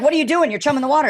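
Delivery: a guide scolding him from the boat; alarmed scolding. Retold or imitated. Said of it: "she's like".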